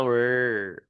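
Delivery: lone "R" held as a smooth untrilled hum; English R sound. Shown as a demonstration. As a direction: sustain "R" without rolling it. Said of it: "ये जो sound निकालो". (बिना लुढ़काए "R" की लंबी आवाज़)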